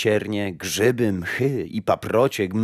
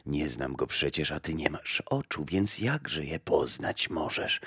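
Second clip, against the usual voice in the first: put-on low, much softer old woman; old grey woman; 4 semitones lower, 5 dB quieter than usual. Old woman tells the blind mother death is not back, low and soft. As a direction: answer like a quiet old gardener of graves.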